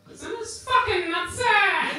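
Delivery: loud, high, slow mock bellow; yelling across the clinic. Receptionist calling out to Steve down the corridor, loud bellow. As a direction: broadcast it to the whole room.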